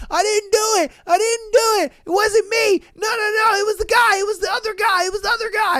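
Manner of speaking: high-pitched, repeated pleas of innocence; mock frantic protest. Man pleads as security roughs him up, mock frantic. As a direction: imitate a panicked man blurting denials.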